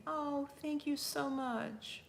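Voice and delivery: ordinary talk, nothing marked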